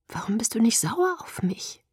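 pleading whisper, pitch lifted. A narrator's reading. Bella asks Edward why he isn't angry, whispering. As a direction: whisper the question, hurt. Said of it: "flüsterte ich".

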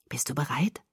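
soft, low, hushed question; low confidential murmur; quietly. Acted. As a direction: ask it under your breath. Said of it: "fragte er leise".

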